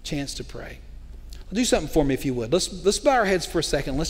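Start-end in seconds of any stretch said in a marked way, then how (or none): 0.0-0.8 hushed. Real, not acted. Echo fading softer.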